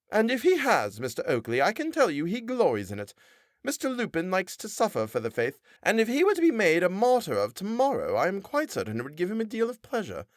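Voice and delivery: high, preachy wife's voice; a pious wife defending the parson to her husband; sanctimonious wife. A narrator's reading. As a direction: lecture him like a pious scold. Missus Oakley voiced high.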